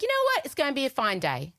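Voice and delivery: bright high chirp; forced cheer. Retold or imitated. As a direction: fake positive morning voice. Forced cheerful self-talk.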